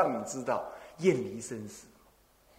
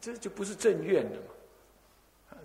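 usual speaking voice

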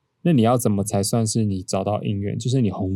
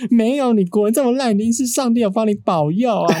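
much higher, eager mother gushing; excited mom imitation; 12 semitones higher than usual. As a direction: mimic the mother bursting with excitement over the grade. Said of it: "然后她说".

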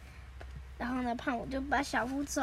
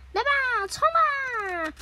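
high, loud, drawn-out rallying call; charging cry; 10 semitones higher, 6 dB louder, much slower than usual. Acted. Nobita sends the others charging, rallying cry.

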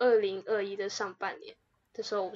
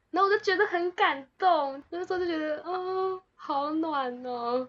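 deeply touched; 7 semitones higher, slower than usual. Real, not acted. Moved by dad's words.

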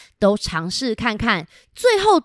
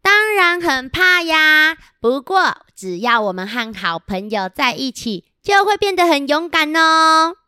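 small animal voice; 7 semitones higher, 3 dB louder than usual. Acted. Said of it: "一边走一边说".

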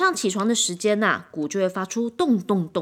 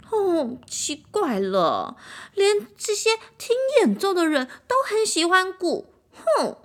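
high, slow, resentful huff; the violin sulking that everyone loves the drum, high and jealous; 9 semitones higher than usual. A narrator's reading. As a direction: grumble with jealousy.

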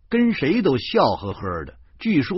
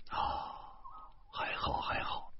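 whispered aside, softer, in a breath; relieved whisper; 16 dB quieter than usual. A narrator's reading. (松了口气的低声耳语)

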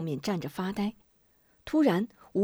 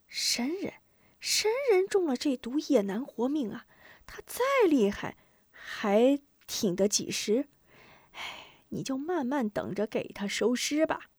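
high, softer character voice; scoffing soldier; 5 semitones higher, 4 dB quieter than usual. Acted. Second soldier dismisses his comrade's awe, high hushed scoffing. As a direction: scoff quietly in a higher-pitched voice.

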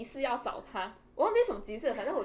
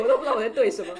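ordinary delivery

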